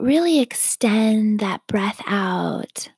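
ordinary talk

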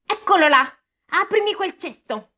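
lightly voiced threatening witch; menacing witch. A narrator's reading. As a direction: demand it with a hint of menace. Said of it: "disse con voce minacciosa".

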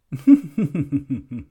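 chuckling demo; chuckle. Shown as a demonstration. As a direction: give a little chuckle. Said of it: "there's the chuckle".

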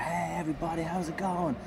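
high, singsong hello to a crowd; showman's greeting. Retold or imitated. Comedian opens the show to a terrified old crowd, high showman's greeting. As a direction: call out a bright stage opener without shouting.

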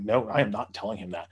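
usual speaking voice

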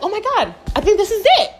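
high, acted burst of hope; excited. Lost hiker thinking she found the trail, high and excited. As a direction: replay the thrill of spotting the way out. Acts hopeful discovery of trail.

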